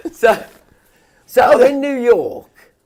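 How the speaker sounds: a high, slow, grand posh voice. Pretend. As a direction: puts on an exaggerated posh tone. Comic posh storytelling voice.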